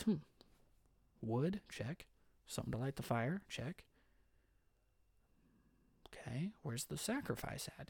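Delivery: soft, puzzled muttering while checking off items; curious boy Isaac. Acted. Young Isaac checks supplies before asking his dad, soft and puzzled. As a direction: quietly tick things off like a curious kid.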